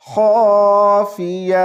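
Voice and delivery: خافیہ with a thick, heavy خا, slow; heavy kha. Shown as a demonstration. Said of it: "خا کو موٹی آواز سے پڑھیں گے".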